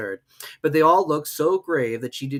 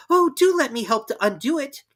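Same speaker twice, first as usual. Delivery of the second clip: put-on higher, louder eager girl; eager Alice; 14 semitones higher, 6 dB louder than usual. Alice offering help, higher and louder. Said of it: "looking anxiously about".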